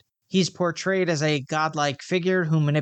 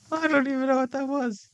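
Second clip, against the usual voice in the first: high-pitched voice, still cracking up; laughing at own flub; 11 semitones higher than usual. Real, not acted. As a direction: squeeze the words out through real laughter. Laughing after own garbled line.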